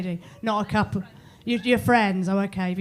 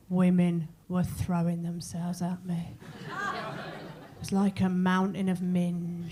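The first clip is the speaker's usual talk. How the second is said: slow, lowered bragging bloke voice; boasting bloke; 4 semitones lower, 5 dB quieter, slower than usual. Retold or imitated. Mocking blokes home from a lads' night, slow bragging drawl. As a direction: brag it like a lad back from a night out. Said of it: "Blokes always feel the need to go".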